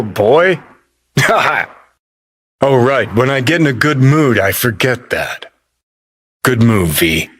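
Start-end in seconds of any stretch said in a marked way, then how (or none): 1.1-1.6 short, high single laugh; derisive laugh. Acted. Laughing at V's jack-in-a-box idea, derisive. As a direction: scoff with a quick laugh.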